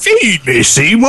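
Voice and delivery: demanding plant monster voice; Audrey II voice. Acted. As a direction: belt it like the hungry plant. Quoting Little Shop plant.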